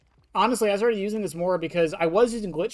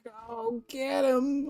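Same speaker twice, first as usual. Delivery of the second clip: high, slow character call with a cracking voice; Funtime Freddy; 8 semitones higher, much slower than usual. Acted. Streamer imitating game character sending Bon Bon off, high cracking voice. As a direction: draw it out high until the voice cracks. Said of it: "My voice is cracking".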